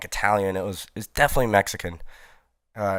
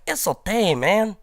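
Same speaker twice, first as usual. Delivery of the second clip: put-on much higher, excited kid voice; imitating the joyful boy; 11 semitones higher, 3 dB louder than usual. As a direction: squeal it like a twelve-year-old playing with toys. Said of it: "was just like".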